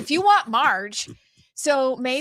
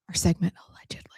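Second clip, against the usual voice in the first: whispered; 4 semitones lower, much faster than usual. Acted. Teasing the segment name.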